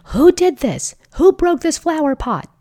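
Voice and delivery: put-on high, loud accusing shout at the child; angry parent yelling. Parent yelling at the child over a broken flower pot, angry shout. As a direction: yell it like a furious parent who found the mess. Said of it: "what would you create if you yelled something like".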